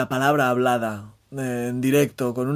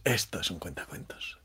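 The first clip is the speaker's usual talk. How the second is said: whispering; 8 semitones lower, 12 dB quieter than usual. Retold or imitated. Imagined remark in bar.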